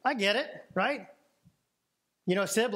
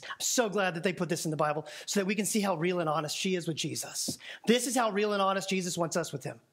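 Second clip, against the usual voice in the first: ordinary delivery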